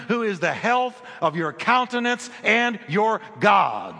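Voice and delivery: high, slow, emphatic declaration building to a shout; rally the congregation, driving each word; impassioned preaching. Real, not acted. Preaches at full force to congregation.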